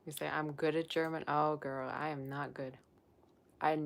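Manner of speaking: ordinary talk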